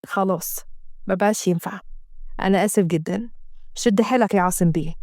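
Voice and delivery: quiet low doctor condolence; doctor's condolence. Acted. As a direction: gently offer condolences. Doctor consoles Asem.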